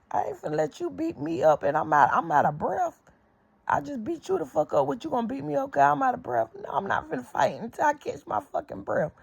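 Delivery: high, fast-ish exasperated yelling about being out of breath; mock fight argument pitched high; heated retort. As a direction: protest hotly while winded. Retelling own quote mid-fight.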